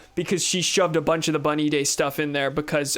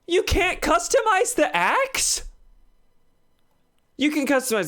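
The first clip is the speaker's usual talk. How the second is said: much higher, slow disbelief; surprised; 11 semitones higher than usual. Real, not acted. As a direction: ask in total disbelief. Shocked by game limitation.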